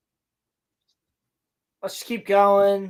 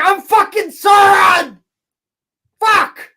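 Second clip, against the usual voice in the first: hammed-up furious yell; 10 semitones higher, 9 dB louder, slower than usual. Sauron blows his cover, screaming in mock rage. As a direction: yell it high and loud, losing it completely. Sauron outs himself, yelling.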